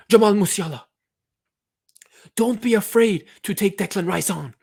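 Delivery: high, loud rallying call; impassioned coach's exhortation; fired-up urging. Acted. Fired-up pep talk to player.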